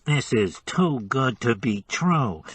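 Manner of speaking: slow, nasal disguised voice. Acted. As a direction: pinch the sound into the nose. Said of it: "he said nasally".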